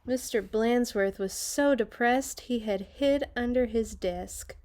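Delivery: ordinary delivery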